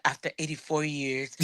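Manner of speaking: low, soft, put-on elderly voice; meme old-lady voice. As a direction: croak it like the famous meme. Meme line about his posting.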